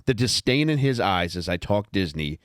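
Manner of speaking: ordinary talk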